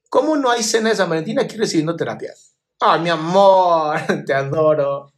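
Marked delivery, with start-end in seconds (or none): 2.8-5.1 slow, laughing and fond; drawn-out ay, then a laugh of affection. Real, not acted. Answering a viewer's Valentine joke, laughing fondly.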